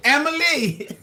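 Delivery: a laughing, high "Emily!"; laughing call. Real, not acted. Laughing call to a viewer's cheeky comment. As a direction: laugh her name out.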